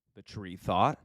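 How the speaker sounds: ordinary delivery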